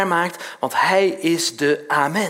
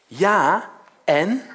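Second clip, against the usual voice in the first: usual speaking voice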